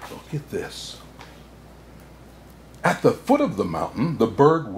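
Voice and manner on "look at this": unvoiced hushed aside; whisper. Santa whispering to children about a picture, hushed. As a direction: show them softly.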